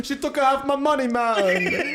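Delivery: bad Jamaican accent. A cohost voicing Bezos about his ex-wife, in a bad Jamaican accent. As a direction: overdo a Jamaican lilt as a divorced billionaire. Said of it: "That was Jamaican".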